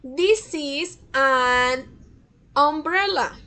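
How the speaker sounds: very slow, each word set apart; deliberate teacher demo, word by word; slow model sentence. Shown as a demonstration. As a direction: read it slowly for the class to repeat. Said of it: "Acá tenemos también un sonido de vocal".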